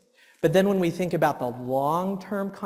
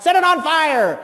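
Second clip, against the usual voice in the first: higher, louder mock fury; angry outburst; 10 semitones higher, 7 dB louder than usual. The frustrated dad blowing up, a hammed-up shout. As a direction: blurt it out hammed-up. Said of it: "And then I'm like".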